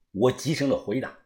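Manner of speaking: ordinary delivery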